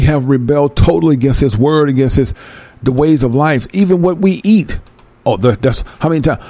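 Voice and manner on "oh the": ordinary talk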